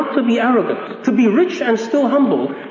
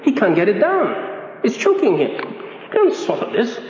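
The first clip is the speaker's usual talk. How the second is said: normal talking voice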